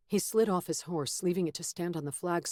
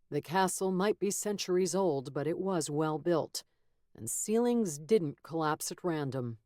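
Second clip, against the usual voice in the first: ordinary delivery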